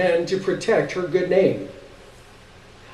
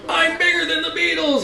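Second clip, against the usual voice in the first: boastful. Acted. Googie shows off to crowd.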